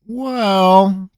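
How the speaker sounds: a long stalling well; slow. Acted. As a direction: stretch the word out, hesitating. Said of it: "said slowly".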